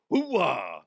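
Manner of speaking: a hint of a Pacino bark; mock Scent of a Woman hoo-ah. A hint of Al Pacino's hoo-ah while joking about him. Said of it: "I can't even do Al Pacino".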